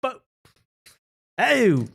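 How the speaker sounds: squeaky caricature of a posh English woman; mock posh British. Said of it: "She doesn't talk like that".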